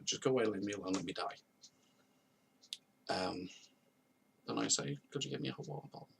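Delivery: usual speaking voice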